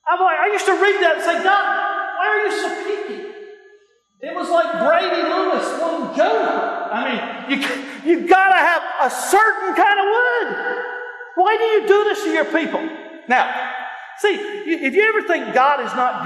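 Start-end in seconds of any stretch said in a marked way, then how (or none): 1.4-3.2 mock complaint to God. Acting out his old complaint to God. 7.6-12.8 high-pitched, incredulous protest to God; exasperated complaint. Acted. Acted complaint to God continues.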